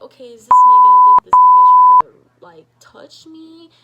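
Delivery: high, loud alarmed scream; panicked yell. Retold or imitated. Fearing Sandy touched her, high panicked scream. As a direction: shriek the fear out loud.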